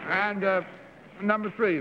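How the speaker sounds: talking with cheeks stuffed full; mouth full. Real, not acted. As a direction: speak around a mouthful of cake. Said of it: "my mouth is full".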